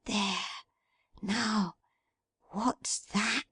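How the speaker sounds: hushed whisper; as if something inside might hear; very softly. A narrator's reading. Meg whispers over the basket, very softly. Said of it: "speaking very softly".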